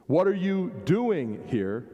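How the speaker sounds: DOING drawn out and lifted; stress on DOING. Shown as a demonstration. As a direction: slowly lean on the word "doing". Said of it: "put the emphasis on a different place".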